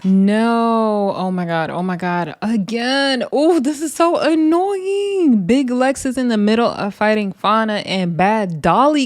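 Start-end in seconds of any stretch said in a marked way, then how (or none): 0.0-5.5 high, slow, dragged-out groan of annoyance; exasperated. Real, not acted. Watching the brawl, she complains, drawn out and exasperated. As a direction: stretch it out, fed up. Fight keeps turning into brawl.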